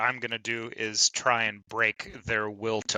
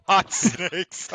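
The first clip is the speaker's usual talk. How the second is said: laughing; 8 semitones higher, 6 dB louder than usual. Real, not acted. Laughing repeat of silly name.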